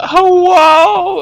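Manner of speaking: long, high, loud mock holler; hello as loud as possible. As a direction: belt out one drawn-out silly hello. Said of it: "as loud as possible".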